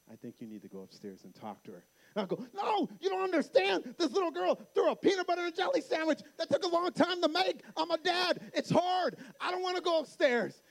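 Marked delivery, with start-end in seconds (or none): 0.1-1.9 very soft, low, quick aside; calm wife. Retold or imitated. Wife sends dad to daughter, imitated quiet calm. Wife calmly advises angry husband. 2.5-10.6 high-pitched comic exasperation; whining dad protest. Retold or imitated. Dad protests to wife about upstairs, acted-out whining.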